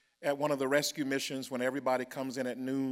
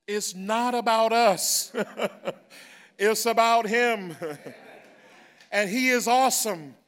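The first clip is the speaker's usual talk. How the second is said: loud, high, slow preacher's praise; full-force preaching; 7 semitones higher, 8 dB louder, slower than usual. Acted. Pastor rousing the congregation to praise God, loud and slow. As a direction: lift the room, shouting glory.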